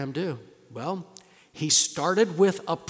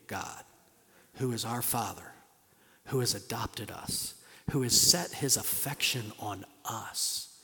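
lower, soft, intimate sermon voice; hushed tender preaching; 5 semitones lower, 7 dB quieter than usual. Acted. Pastor tells the congregation of God's adoption, softly. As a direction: draw them close and speak gently.

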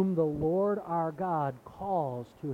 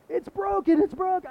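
very high, much louder panicked shriek; full panic; 9 dB louder than usual. Retold or imitated. Boy panicking to his mother, shrieking high and loud. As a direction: shriek it, unable to think of anything else. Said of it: "I was, like, in full panic".

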